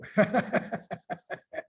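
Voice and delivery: short breathy chuckle; a quick amused laugh; light laugh. Real, not acted. Laughs after thank-you exchange.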